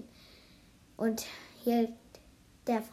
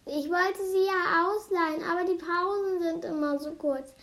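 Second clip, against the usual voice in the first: higher, louder pleading excuse; anxious; 6 semitones higher, 5 dB louder than usual. A narrator's reading. Little girl confessing to the children, anxious. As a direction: plead your case with mild anxiety. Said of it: "Das Mädchen stotterte ängstlich".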